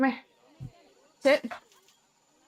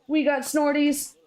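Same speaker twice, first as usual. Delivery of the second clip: laughing; 5 semitones higher than usual. Real, not acted. Laughing at dog's snorts.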